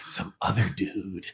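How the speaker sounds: quiet retold punchline; soft, secretive impersonation; hushed reply. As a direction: drop right down in volume. Repeats Pauly Shore's hushed reply.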